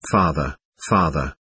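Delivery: understated breaking voice, low and soft; narrator's restrained, low, grieving plea. A narrator's reading. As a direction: let the voice sink softly, as if about to break. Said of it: "His voice broke".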